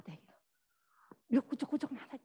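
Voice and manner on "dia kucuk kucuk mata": normal talking voice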